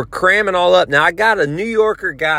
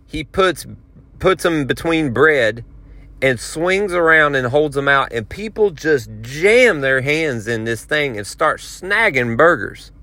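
ordinary talk, nothing marked

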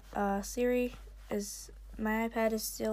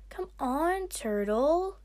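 high, loud coaxing call; raised voice, swooping upward; shouted; 6 semitones higher, 5 dB louder, slower than usual. A narrator's reading. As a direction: call out with rising urging. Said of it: "Kinkajou shouted at the sea wing".

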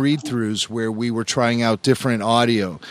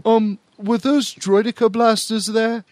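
higher-pitched imitation of a questioning colleague; Paul; 8 semitones higher than usual. Colleague interrupts a pregnant pause with a query, imitated higher. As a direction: put on a colleague's voice, raised in pitch. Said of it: "I hear Paul's voice go".

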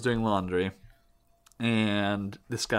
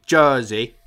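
bad New Jersey accent; 6 dB louder, slower than usual. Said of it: "This is a really bad accent".

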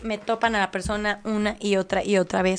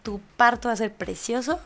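reassuring; 7 dB quieter than usual. Retold or imitated. Softly reassuring the pregnant woman about her baby. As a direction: soothe her gently. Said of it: "Le dije".

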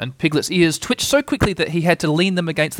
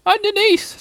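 tiny, very high strained voice; underneath-sounding squeak; 3 dB louder than usual. A narrator's reading. Piglet squashed under Pooh answering, small and squeaky. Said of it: "in an underneath kind of a way".

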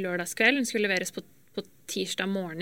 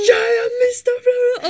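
crying, much higher and louder, slowed; retold crying; 10 dB louder, slower than usual. Panicked daughter on the phone to dad, crying loudly. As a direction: sob it out, voice breaking high. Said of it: "gikk det over i liksom hylgråt".

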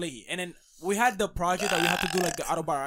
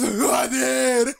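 loud, drawn-out raspy voice; raspy impression of a friend, loud and high; 5 semitones higher, 8 dB louder, much slower than usual. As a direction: imitate his rasp, stretching the words out loud. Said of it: "it's, like, very raspy".

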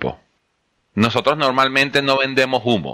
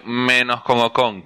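usual speaking voice

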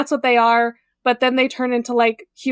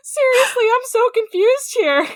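much higher-pitched, exasperated bafflement; baffled protest; 11 semitones higher than usual. Real, not acted. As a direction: squeak out your confusion, high all through. Baffled by the show's premise.

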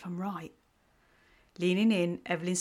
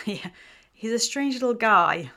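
chuckling; 4 semitones higher, 7 dB louder than usual. A narrator's reading. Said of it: "Alyssa chuckled".

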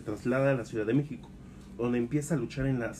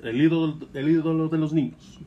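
ring announcer; 4 semitones higher, 7 dB louder than usual. Acted. Ring introduction of Atlantis.

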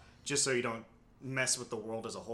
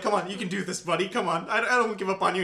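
lightly raised, coaxing encouragement; encouraging god voice; 5 semitones higher, 5 dB louder than usual. Retold or imitated. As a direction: cheer him on like a patient coach. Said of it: "helping him to, like".

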